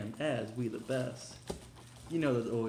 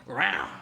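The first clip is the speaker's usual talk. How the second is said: loud barked rah; hype shout; 10 dB louder than usual. Real, not acted. Hyping up the cat season to viewers, loud barked yell.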